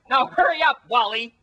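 high, loud bossy shout; bossy yell. Acted. Tina yelling at Wally to hurry up, loud and bossy.

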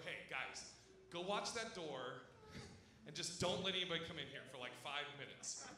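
low, quiet aside to buddies; hushed conspiring. Retold or imitated. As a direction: let your friends in on a plan, low and quiet. Conspiring with friends in a story.

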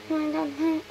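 usual speaking voice

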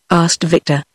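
ordinary delivery

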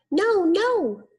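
very high squeaky refusal; little pig voice. A narrator's reading. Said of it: "said the little pig".

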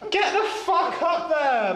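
high, slow laughing yell; high yelp. Real, not acted. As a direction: yelp it up the shaft. Shouting up, laughing.

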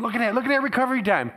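fast, high and loud amazement; excited doctor. Retold or imitated. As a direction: gush over the scan. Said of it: "he was all excited".

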